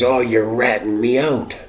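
high mocking whine of the drunk. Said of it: "he looks at me and he says".

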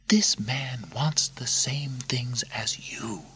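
high, slow, unvoiced whisper; whispered persuasion. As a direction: coax him in secret. Narrator voices Lucilla.

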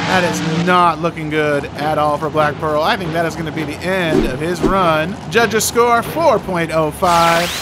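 ordinary delivery

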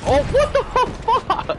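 very high, loud, slow disbelief; shocked outburst. Real, not acted. Gamer shot out of nowhere, shocked outburst. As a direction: screech it out in stunned outrage.

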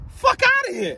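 high, scoffing brush-off; dismissive scoff. Real, not acted. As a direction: wave it off with a sharp scoff. Dismissive outburst, pitch jump.

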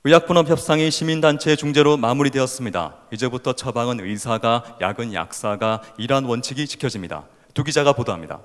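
recited TV news anchor read. Acted. Speaker recites memorized anchor script to audience, anchor voice. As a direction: deliver it as a projected, formal news report. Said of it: "그 앵커 스크립트가 기억이 나요".

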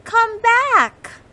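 high, loud mouse yell. Acted. Molly yells at the rolling snowball to come back, high and loud. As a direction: yell after the runaway snowball. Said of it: "yelled Molly".

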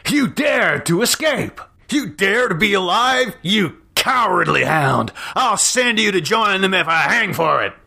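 high, raging accusation; furious threat. A narrator's reading. Yancey threatening the stranger at gunpoint, enraged. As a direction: spit it at him, ready to shoot.

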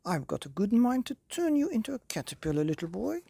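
high, fussy godmother scolding; huffy old fairy threatening; cross fairy godmother. Acted. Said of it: "she said".

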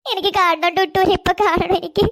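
slow, sobbing plea; crying. Acted. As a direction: beg through tears to see her. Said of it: "കരയണ്ട പാറുക്കുട്ടി". (കരഞ്ഞുകൊണ്ട്)

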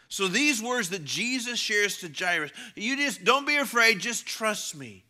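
high, loud, urgent sermon delivery; emphatic preaching. Real, not acted. Preacher shares Jesus' words to Jairus, emphatic. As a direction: press the words home from the pulpit.

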